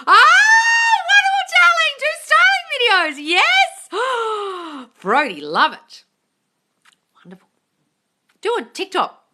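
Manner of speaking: very high, loud delighted cheering; shower him with thrilled encouragement; gushing cheer. Real, not acted. Host cheering Brody on his podcast plan, very high and loud.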